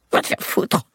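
put-on high, breathless hiss of a cornered old man; hissed curse. Cornered old thief cursing the armed boy, hissed.

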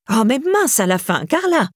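fed-up exclamation, jumping high on the name; exasperated outburst. A narrator's reading. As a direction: snap out of patience at someone not listening. Irène loses patience with Carla.

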